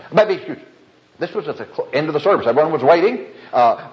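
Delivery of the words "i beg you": higher, rushed, words swallowed into one blur; slurred fast accent. Retold or imitated. Deacon repeating his excuse to the preacher, higher, garbled and fast. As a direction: blurt it too fast to be understood. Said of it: "you said it so fast".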